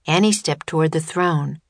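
ordinary delivery

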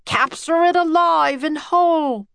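high, eager order; commanding call. A narrator's reading. Professor wanting the octopus for science, commanding call.

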